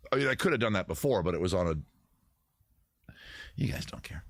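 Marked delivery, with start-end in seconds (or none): none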